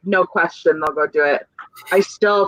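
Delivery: ordinary talk, nothing marked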